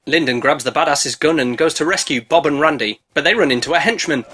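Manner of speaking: ordinary delivery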